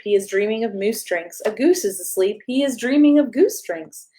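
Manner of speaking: plain reading voice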